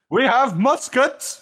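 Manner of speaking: high, slow mock boast; mock threat. Settlers threatening natives with weapons, mock menace.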